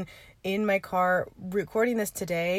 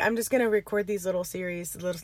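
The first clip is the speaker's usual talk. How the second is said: ordinary talk, nothing marked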